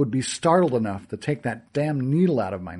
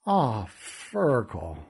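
slow, softer sigh of a name; sad realisation; 3 semitones lower, 5 dB quieter, much slower than usual. Acted. Narrator realising Fergal was deceived, slow sad sigh. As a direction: let the pieces fall into place.

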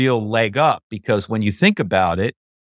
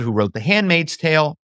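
ordinary delivery

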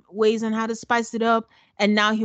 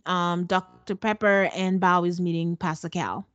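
ordinary talk, nothing marked

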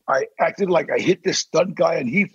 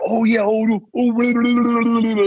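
higher, louder gushing babble; mocking fans fawning over movie stars, higher and louder; 6 semitones higher, 4 dB louder than usual. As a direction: ham up a starstruck fan's gushing.

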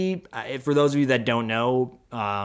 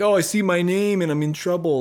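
mock whiny kid; 6 semitones higher, 3 dB louder than usual. Mocking a kid's reaction.

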